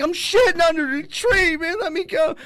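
high-pitched exasperated protest; mock pleading yell. Caught man answers the hose-wielding lady, high pleading yell. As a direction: cry out, begging to be left alone. Arguing back while being hosed.

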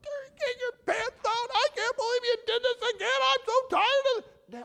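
hammed-up high screaming rant; emotional outburst. Father mocking how not to spank, frantic screaming. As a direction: shriek it as a parody of a parent losing control. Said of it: "you're not emotional about".

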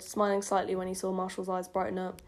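usual speaking voice

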